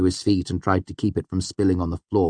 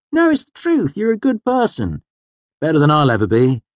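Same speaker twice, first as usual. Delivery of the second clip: tipsy Kvothe; 10 semitones higher, 7 dB louder than usual. A narrator's reading. Drunk Kvothe voiced.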